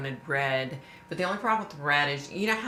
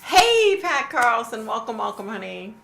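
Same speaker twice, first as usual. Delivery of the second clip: loud greeting; 7 semitones higher, 9 dB louder than usual. Real, not acted. Greeting viewer joining live stream.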